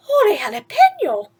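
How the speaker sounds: loud, high burst of surprise; excited exclamation. Acted. Skippy after crashing into toys, exclaiming loudly. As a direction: shout it out with a jolt. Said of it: "exclaimed Skippy John Jones".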